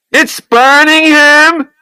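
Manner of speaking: high, loud, slow panicked scream. A narrator's reading. Raymond screaming that the water burns the baby, high, loud, slow. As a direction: drag out the scream in horror. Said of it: "he screamed".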